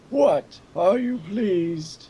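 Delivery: put-on slow, high old man voice. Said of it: "extreme old man voice".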